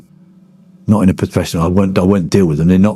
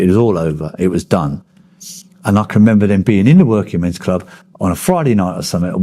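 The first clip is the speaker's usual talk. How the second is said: usual speaking voice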